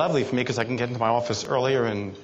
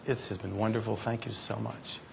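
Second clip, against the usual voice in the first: soft pretend thanks; 3 semitones lower, 9 dB quieter than usual. Pretend closing of a silent talk.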